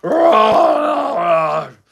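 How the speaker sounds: loud, long playful roar; lion roar. A narrator's reading. Father chasing Anne out of the room, roaring. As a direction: roar like a lion giving chase. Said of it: "roaring like a lion".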